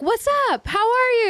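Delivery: high, breezy hello; casual American greeting. Retold or imitated. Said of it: "We say, like".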